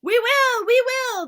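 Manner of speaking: very high, loud grinding chorus; dentist-drill chorus. Acted. Witches agreeing together, high grinding screech. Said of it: "voices were like a chorus of dentist drills".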